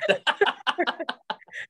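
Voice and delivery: high rising burst of laughter. Real, not acted. Laughs at her teasing.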